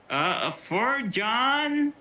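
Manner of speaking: drawn-out, high rise on "John"; questioning call. Acted. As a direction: stretch "John" way up into a doubtful question. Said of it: "And I say it loudly".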